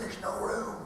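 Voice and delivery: hushed refusal whispered. Retold or imitated. Dad whispering a refusal to his son in the bunk. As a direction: whisper it, tired and cramped.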